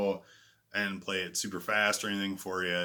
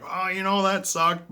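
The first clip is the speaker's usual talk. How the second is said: higher, louder quote of himself; imitated self-critique; 9 semitones higher, 6 dB louder than usual. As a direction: play up your own reaction to a flop. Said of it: "there's times where I'm like".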